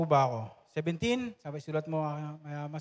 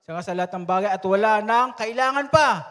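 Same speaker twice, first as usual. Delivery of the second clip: mock boast; 4 semitones higher, 8 dB louder than usual. Pastor voices the boastful church.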